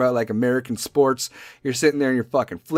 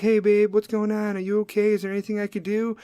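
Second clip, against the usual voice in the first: higher, light check-in with babe; concerned partner; 8 semitones higher than usual. Acted. Man checking on his angry partner, concerned and careful. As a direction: pitch it up, sweet and careful.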